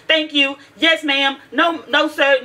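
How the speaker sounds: crisp high military-style answers; military-style reply. Retold or imitated. Parent showing daughter how to answer people, military-style. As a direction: sound off each reply like a recruit. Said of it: "like you're in the military and say".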